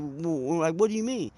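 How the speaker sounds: stammered, pitch jumping up; stuttering. Retold or imitated. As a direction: stumble over the words in shock. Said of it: "I, I stuttered and I said".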